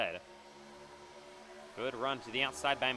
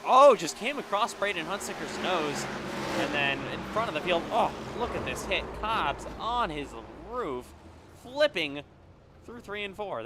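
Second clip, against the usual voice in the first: rising, louder play-by-play as the car flips; excited crash call; 5 semitones higher, 6 dB louder than usual. Real, not acted. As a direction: call the wreck as it happens, voice climbing. Crash call during live race commentary.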